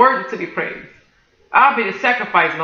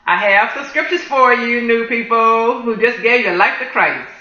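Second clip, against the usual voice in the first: usual speaking voice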